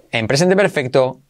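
ordinary delivery

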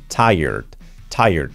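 "tired" as "TAI-erd", low and falling; d al final. Shown as a demonstration. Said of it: "poniendo una D al final". ("tired" como "táierd", grave, con d final)

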